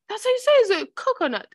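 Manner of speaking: very high, loud insistence; acted-out indignant comeback; mock protest. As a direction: argue back in a squeaked-up voice. Acting out TikTok argument.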